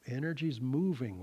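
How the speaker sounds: ordinary talk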